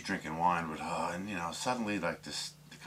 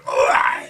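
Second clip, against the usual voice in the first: loud, voiceless retch of disgust; revolted yell; 5 semitones lower, 16 dB louder than usual. Retold or imitated. Man revolted in bed mid-act, loud disgusted yell. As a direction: blurt out a big mock-revolted "ugh". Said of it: "he's, like, so revolted and disgusted".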